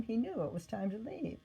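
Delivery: plain reading voice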